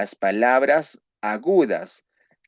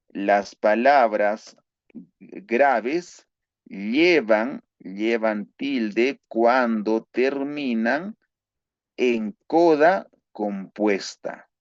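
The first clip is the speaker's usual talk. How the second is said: very slow, drawn-out dictation; dictating the third rule slowly; much slower than usual. Shown as a demonstration. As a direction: stretch every word so the class can write it. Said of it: "dictating third rule slowly".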